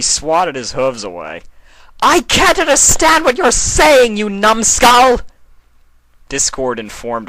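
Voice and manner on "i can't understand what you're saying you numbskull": much higher, louder yell; angry yell. A narrator's reading. Nightmare Moon yells at Sombra after swatting him away. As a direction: blast him off your face.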